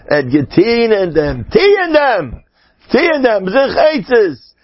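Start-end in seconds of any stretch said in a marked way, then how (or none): 1.5-4.5 high-pitched urging, repeated with force; urgent exhortation. Acted. Preacher urging listeners to work on it, insistently. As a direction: cry "tie in dem" high and insistently.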